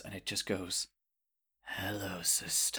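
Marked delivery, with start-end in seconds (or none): 1.6-2.8 soft, low, whispery creature greeting. Acted. Salazar the tree mollusk greeting the sister, low hushed voice. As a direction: breathe it out low and quiet. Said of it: "it's kind of a low voice".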